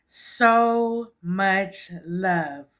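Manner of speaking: stretched very slowly, every word held; thanking her viewers, drawn out very slowly. Real, not acted. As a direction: draw each word out long and savoring.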